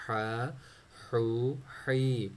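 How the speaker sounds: ہا ہو ہی breathed slowly from the middle throat; slow throaty ha, ho, hi demo. Shown as a demonstration. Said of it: "ہمیں بنانے کی ضرورت ہے middle throat میں".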